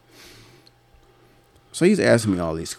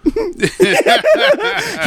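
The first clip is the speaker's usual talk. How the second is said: high cackle. Real, not acted. Laughing at his own story.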